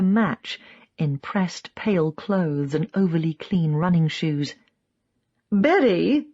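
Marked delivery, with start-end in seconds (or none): 5.5-6.3 Southern drawl calling out Betty; Southern American tourist, broad accent. A narrator's reading. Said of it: "in a broad southern accent".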